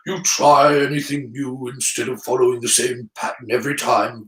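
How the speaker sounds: put-on saddened old wizard voice; weary Dumbledore. Dumbledore complaining of how people react to him, saddened and weary. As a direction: lament wearily in a put-on headmaster's voice. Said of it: "looking rather saddened and weary".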